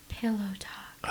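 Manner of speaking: slow breathy seductive whisper; sexy whisper. Pretend. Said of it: "Oh, Pillow Talk".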